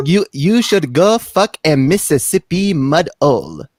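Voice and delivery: slow, mocking Pimp Monk insult; lightly voiced pimp telling him off; Pimp Monk accent. Pimp cursing out the host, slow in the Pimp Monk accent. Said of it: "this is the best, uh, accent".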